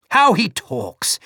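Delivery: high, slow, sharp exasperation; exasperated aunt, sharply. Acted. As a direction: scold him sharply. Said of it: "exclaimed my aunt sharply".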